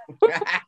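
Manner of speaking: quick amused laugh; a brief chuckle; short laugh. Real, not acted. Laughing at own joke.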